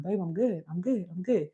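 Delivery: soft, dismissive i'm good; husband brushing off. Retold or imitated. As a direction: quietly shrug it off like the husband. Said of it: "my husband would be like".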